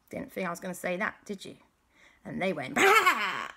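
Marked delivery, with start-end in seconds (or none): 2.7-3.5 loud, high "bah" burst; sudden battle noise. Acted. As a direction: make the sound of shields going up. Shields go up.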